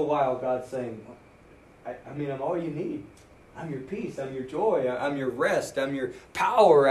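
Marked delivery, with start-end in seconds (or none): 1.8-6.9 much softer, tender voice; gentle pleading. Retold or imitated. Preacher voicing God to a wandering believer, soft tender plea. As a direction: lay each promise down softly.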